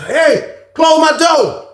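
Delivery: high-pitched retold call. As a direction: call it out high, without extra volume. Retold calling out to someone.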